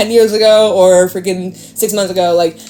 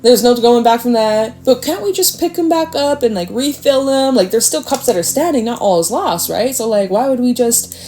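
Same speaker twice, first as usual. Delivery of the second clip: higher, coaxing voice bargaining with herself; mock hopeful client; 6 semitones higher than usual. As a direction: lightly play the client talking herself into staying.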